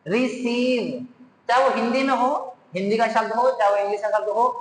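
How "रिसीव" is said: रिसीव slowly, with a long drawn-out ee; रिसीव spoken slowly, ee held long; stretched ee. Shown as a demonstration. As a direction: drag the ee of रिसीव out. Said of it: "खींच कर पढ़ेंगे".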